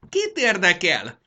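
loud, slow, high screech; shrill tantrum outburst. Acted. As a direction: shriek it impatiently. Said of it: "rikácsolt Mondán".

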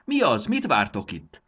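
high, loud challenge to the crowd; loud, boisterous question. A narrator's reading. Barbó asks the gathered crowd what they wait for, boisterously. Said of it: "kérdezte harsányan Barbó".

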